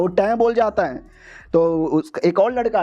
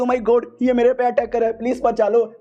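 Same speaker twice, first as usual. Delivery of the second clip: higher, hammed-up damsel cry for help; mock panicked cry; 6 semitones higher than usual. Girl under attack begs the hero to save her, higher and hammed-up. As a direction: squeal for rescue, overdone. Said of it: "लड़की भी चिल्लाती".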